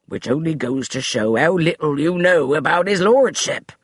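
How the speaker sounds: lightly voiced snippy butler; butler's sharp comeback; retort. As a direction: snap back at her. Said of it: "Finch retorted".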